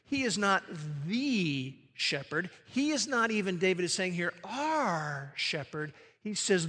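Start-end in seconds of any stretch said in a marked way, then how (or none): 1.1-2.5 "the" stretched slow and soft; a hushed, slowed "the shepherd" with the stress on "the". Shown as a demonstration. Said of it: "the second key word". 4.4-5.9 "our" drawn out slow and quiet; a soft, slowed "our shepherd", weight on "our"; stress on OUR. Shown as a demonstration. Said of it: "the second key word".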